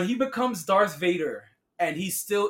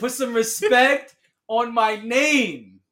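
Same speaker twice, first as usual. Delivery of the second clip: loud, higher, drawn-out demand; emphatic demand; 7 semitones higher, 10 dB louder, slower than usual. Acted. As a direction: punch it out slowly and loudly. Acted demand in heated correction.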